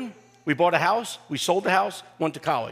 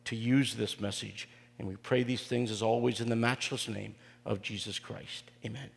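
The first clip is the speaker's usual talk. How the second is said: soft, lower prayer voice; 5 semitones lower, 5 dB quieter than usual. Acted. Pastor opening the sermon with a quiet prayer to God. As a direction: pray it quietly and reverently.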